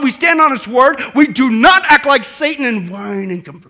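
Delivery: loud, driving sermon delivery; full-force preaching. Real, not acted. Preacher answering the devil with scripture, forceful preaching. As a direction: hammer each phrase home to the congregation.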